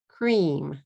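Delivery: "Cream" in one long falling beat; one-syllable English. Shown as a demonstration. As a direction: say "Cream" with the cr joined and a closed m. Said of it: "One syllable".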